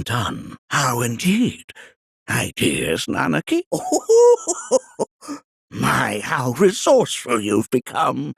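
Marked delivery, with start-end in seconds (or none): 3.7-5.0 put-on sudden high-pitched old man's cackle. Old sage chuckling at Nanaki, high cackle.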